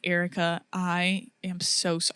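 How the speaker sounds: soft, slow sincere apology; imitated genuine apology. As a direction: imitate someone softly, earnestly saying sorry. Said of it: "he was, like, genuine".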